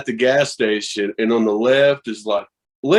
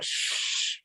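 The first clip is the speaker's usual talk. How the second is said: a quick unvoiced shush; hushing whisper; 13 dB quieter than usual. Shushing the shop clerk who offers weed, whispered. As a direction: hush him fast so nobody hears.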